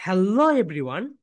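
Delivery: very slow "Hello", its second syllable swooping up; stretched stress on Hello. Shown as a demonstration. Teacher leads the whole class in the greeting, stretched stress on "Hello". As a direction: draw out "Hello" and end "everyone" on a lift. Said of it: "সবাই বলি".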